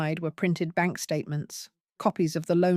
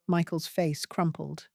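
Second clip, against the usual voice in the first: plain reading voice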